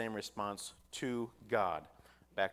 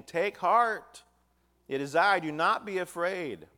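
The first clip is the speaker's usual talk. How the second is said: commanding reassurance; 8 semitones higher, 9 dB louder, slower than usual. Acted. Preacher voices Jesus calming disciples.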